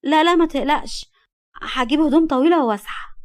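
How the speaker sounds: put-on high, loud girlish assurance; eager young Nagma. A narrator's reading. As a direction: promise him brightly and insistently. Girl eagerly reassures him.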